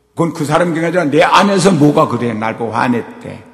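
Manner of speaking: normal talking voice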